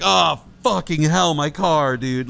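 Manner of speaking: loud upset swearing; dismayed curse. Retold or imitated. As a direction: groan it out at the wrecked bumper. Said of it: "And I was so mad".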